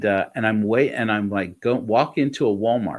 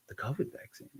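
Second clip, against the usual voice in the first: softer hushed whisper; whispered question; 2 semitones higher, 16 dB quieter than usual. Retold or imitated. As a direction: whisper it as if it's secret. Hushed reaction to vaccine.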